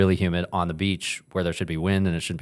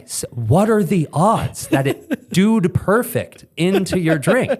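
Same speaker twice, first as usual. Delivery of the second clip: high-pitched, slow, laughing amazement; incredulous, breaking into laughs; laughing disbelief; 9 semitones higher, slower than usual. Retold or imitated. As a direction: marvel through laughter, drawing it out. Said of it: "we started laughing".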